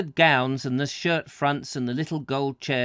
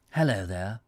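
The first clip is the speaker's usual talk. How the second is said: catchphrase impression; 6 semitones lower, 3 dB quieter than usual. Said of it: "who used to go".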